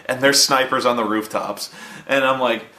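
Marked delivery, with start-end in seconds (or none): none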